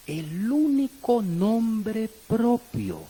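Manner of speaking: very slow, drawn-out emphasis; emphatic repetition. Real, not acted. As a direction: stretch each word so it sinks in. Preacher repeats key point emphatically.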